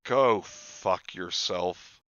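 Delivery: ordinary talk, nothing marked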